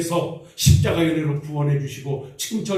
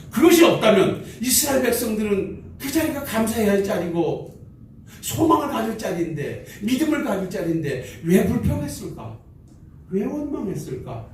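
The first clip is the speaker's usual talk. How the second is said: raised rhetorical questioning; preaching; 6 semitones higher than usual. Real, not acted. Pastor asking the congregation why Israel grumbled, in a raised preaching voice. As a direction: keep pressing the why.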